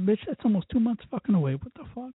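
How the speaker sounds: high incredulous retort; indignant outburst. Retold or imitated. Retorting to the shop worker, high and incredulous. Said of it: "I'm like".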